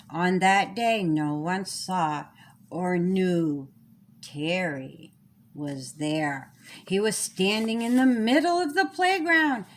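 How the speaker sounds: ordinary delivery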